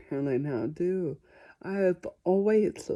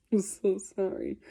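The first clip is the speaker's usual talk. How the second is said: sorry said through a giggle; trying to apologize but the laugh slips out; laughing apology. Real, not acted. Apologizing to viewers while giggling. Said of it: "There's no reason for me to be laughing".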